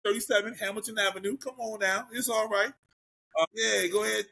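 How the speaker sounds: high, hyped-up promo voice, pitched well up; mock sales pitch. Plugging his own studio to listeners, mock hype ad voice. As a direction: sell it like a cheesy local ad. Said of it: "You better sell yourself, sir".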